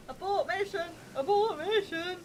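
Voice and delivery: mock alarm call. Calls retreat from the spiders.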